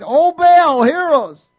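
desperate loud cry to a false god, pitched high; crying out to Baal. Acted. Baal's prophets pleading loudly at the altar, desperate shout. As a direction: call out as if begging to be heard.

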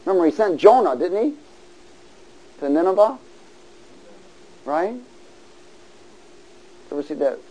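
ordinary talk, nothing marked

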